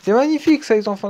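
high and loud exclamation; thrilled cry; excited. Real, not acted. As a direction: burst out in delight. Still celebrating Kanté.

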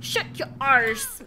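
loud, playful shout at the screen; half-laughing loud retort; mock yell. Real, not acted. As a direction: bark it out in jest. Yelling at game character.

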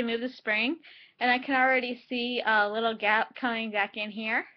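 lip pulled aside by finger; talking while showing teeth; finger in mouth corner. Real, not acted.

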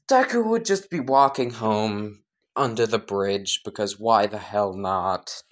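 ordinary delivery, nothing marked

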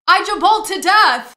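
real laughing yell at a ridiculous death; hysterical laughing shout. Real, not acted. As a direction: scream it out, helpless with laughter. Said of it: "I'm still hysterical".

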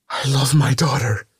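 high, loud cry of a mother. A narrator's reading. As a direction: shout your love at the demon. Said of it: "she cried".

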